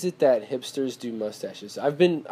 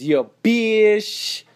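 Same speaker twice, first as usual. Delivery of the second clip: loud, high drawn-out mock shout-out; radio show shout-out; 9 semitones higher, 6 dB louder than usual. Host mimicking the Dirty Boys' radio slogan, drawn-out shout-out. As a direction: belt it out like a hype radio tag.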